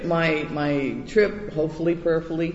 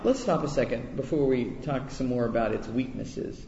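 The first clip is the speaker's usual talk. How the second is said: normal talking voice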